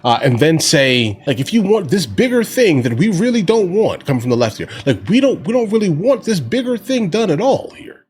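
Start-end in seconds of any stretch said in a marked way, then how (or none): 1.4-4.0 higher, mocking politician voice; hammed-up sly lawmaker, slightly raised; mock Democrat dealmaker. Mocking Democrats in a backroom deal. 5.1-8.0 higher, mocking politician voice; hammed-up sly lawmaker, slightly raised; mock Democrat dealmaker. Mocking Democrats in a backroom deal.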